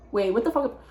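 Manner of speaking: ordinary talk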